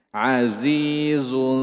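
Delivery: Azizun drawn slowly with a thin nasal zun; thin ikhfa. Shown as a demonstration. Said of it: "dibaca tipis". (azizun dengan ikhfa tipis)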